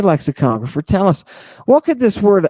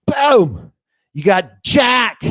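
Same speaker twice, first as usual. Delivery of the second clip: exuberant outburst; 6 semitones higher, slower than usual. Real, not acted. Triumphant outburst to class.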